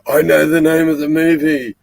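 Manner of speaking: high, loud mock eureka; sudden realization. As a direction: blurt it out as if just remembered. Acts out waking up remembering.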